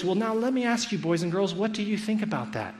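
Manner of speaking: mock storytime-teacher questioning; teacher addressing children. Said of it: "And she says".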